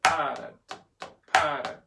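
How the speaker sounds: PA of Paradiddle punched loud, the rest low; stressed first syllable. Shown as a demonstration. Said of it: "accents ... play the rest of the paradiddle lower".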